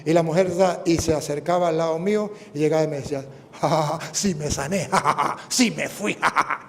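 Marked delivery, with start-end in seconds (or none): 3.6-6.6 strange, slowed laughing voice; laughing with relief. Retold or imitated. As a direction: laugh as if freed. La mujer ríe sanada. (risa de alivio, extraña y lenta)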